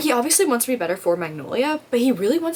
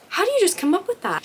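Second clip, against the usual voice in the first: amazed; 11 semitones higher than usual. Retold or imitated. Amazed by the writing.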